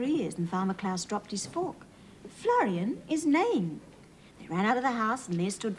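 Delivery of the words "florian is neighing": excited. A narrator's reading. Excited story discovery.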